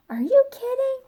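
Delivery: a frightened piggy scoffs back in a high whisper; breathy high pig squeak; squeaky pig. A narrator's reading. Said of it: "said Porter".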